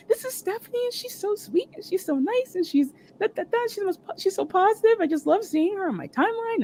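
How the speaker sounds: high, sweet gushing imitation; gushing introduction. Star bragging about a fan to her helper, gushing. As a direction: rattle off praise in a high excited voice.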